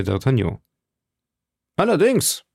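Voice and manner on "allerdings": a firm, much higher affirmation; admirably firm. A narrator's reading. Aramis and Porthos assure D'Artagnan, firm and certain. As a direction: say it with unshakable resolve. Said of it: "mit bewundernswerter Festigkeit".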